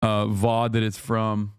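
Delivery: usual speaking voice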